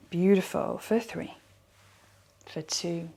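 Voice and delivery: soft, unhurried countdown and cues; guided meditation. Acted. Teacher leading a squeeze and release, soft meditation voice. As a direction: lead them gently through it, leaving long pauses.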